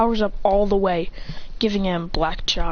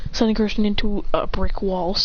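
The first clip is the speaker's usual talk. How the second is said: ordinary delivery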